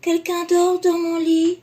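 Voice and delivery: high, loud little bear; Baby Bear pointing at the bed, high and excited. Acted. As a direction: squeal like a small cub.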